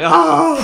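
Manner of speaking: hammed-up cry of dismay; mock dismayed "oh". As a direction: groan like a crowd whose win just vanished. UN men's dismay at survival.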